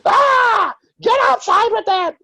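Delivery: high frantic shriek; panicked woman yelling them out of her house. Retold or imitated. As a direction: shriek like a scared host. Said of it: "She's like".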